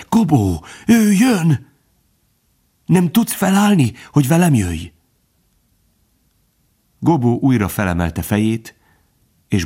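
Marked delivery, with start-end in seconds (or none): 0.1-4.9 high-pitched, frightened urging; urgent pleading. A narrator's reading. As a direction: urge them up in a high, desperate voice. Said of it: "suttogta sürgetve". (sürgetve, rémülten, magas hangon)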